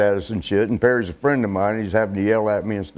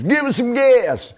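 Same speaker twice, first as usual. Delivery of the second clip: bossy shout; 11 semitones higher, slower than usual. Retold or imitated. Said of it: "He goes".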